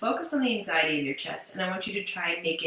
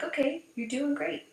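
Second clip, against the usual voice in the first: encouraging mom; 8 semitones higher than usual. Retold or imitated. Mother soothing crying toddler.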